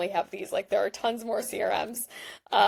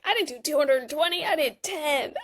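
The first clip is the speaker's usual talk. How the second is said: higher, indignant pushback over the token count; indignant protest; 6 semitones higher than usual. Retold or imitated. User to the app about a token count, indignant and pitched up. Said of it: "I'm like".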